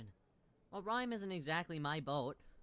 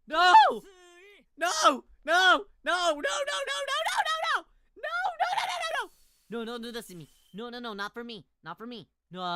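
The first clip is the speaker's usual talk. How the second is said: high, loud repeated cries; frantic real shouting; panicked no-no screaming; 12 semitones higher, 15 dB louder, slower than usual. Real, not acted. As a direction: yell a rapid string of refusals. Streamer frantically refuses what is happening.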